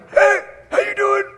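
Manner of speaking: high strained croak; imitating a voice that has given out; lost voice. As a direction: squeak out a greeting with no voice. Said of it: "my voice started to leave".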